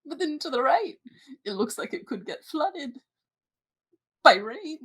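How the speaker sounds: a hint of old guide pointing ahead; very old man. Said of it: "I was gonna do an old man voice".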